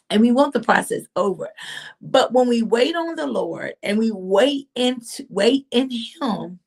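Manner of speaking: normal talking voice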